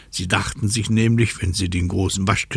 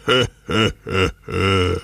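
North Wind's ho-ho laugh; mock wild wind laugh. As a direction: laugh in four separate gusts. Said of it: "lachte sein wildes".

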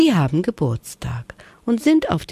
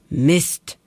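short annoyed grumble; mild cross grumble; grumbling curse. A narrator's reading. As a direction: spit out a small curse. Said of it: "schimpfte Hänschen".

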